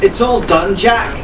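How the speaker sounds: shouting to Jack above the storm; shouted over the storm. Acted. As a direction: call out over the wind and rain. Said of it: "Arabella shouted over the roar".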